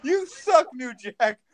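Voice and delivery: high, loud jeer in play; mock heckle. Fan imagining someone heckling New Jack, high mock shout. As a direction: call out like a foolhardy heckler.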